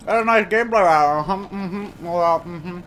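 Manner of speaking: talking while chewing ice; mouth full. Real, not acted. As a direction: talk through a mouthful of ice. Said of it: "I shouldn't talk with my mouth full".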